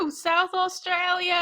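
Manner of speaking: high, slow party whoop; excited cheer. Retold or imitated. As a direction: cheer it like a dancing fan. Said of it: "that one Vine".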